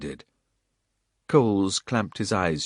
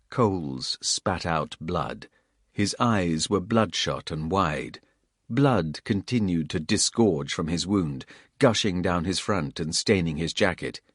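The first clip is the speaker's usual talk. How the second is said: ordinary delivery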